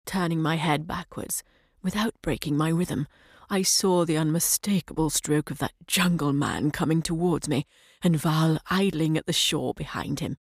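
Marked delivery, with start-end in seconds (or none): none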